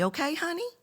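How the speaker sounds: high, sugary, soft okay honey; sweet condescending. Retold or imitated. Telling girls to be nice, high and sugary. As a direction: pat her on the head. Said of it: "Women are taught".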